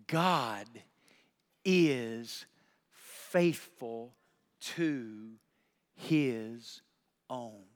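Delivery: slow emphasis. Real, not acted. Slow word-by-word statement.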